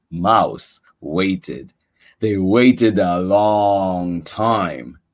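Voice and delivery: ordinary delivery, nothing marked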